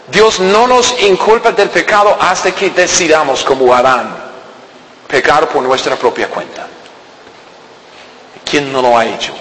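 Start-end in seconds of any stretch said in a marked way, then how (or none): none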